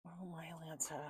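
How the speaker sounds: soft whispered oh my Lanta; a whisper of disbelief at the cards; whispered exclaim. Real, not acted. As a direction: breathe it out as a whisper. Muttering over the cards.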